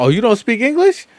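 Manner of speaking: mock Russian local. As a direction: play a scornful local catching a foreigner out. Imagined Russian scolding a foreigner.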